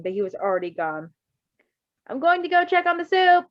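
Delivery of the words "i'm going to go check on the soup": raised, higher call down the hall; called from the hallway. A narrator's reading. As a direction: call it from another room. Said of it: "he called from the hallway".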